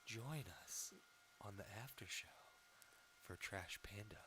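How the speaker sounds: low, slow, hushed seductive voice; sexy voice. Shown as a demonstration. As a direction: breathe it slowly like a sex line operator. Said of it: "you gotta be like".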